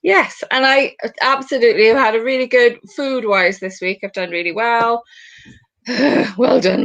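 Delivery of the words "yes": usual speaking voice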